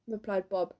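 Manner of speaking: plain reading voice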